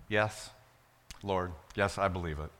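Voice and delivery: soft, low, understated yes; simple answer. Acted. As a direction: answer quietly and simply, acting the beggars. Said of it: "And they answer just simply".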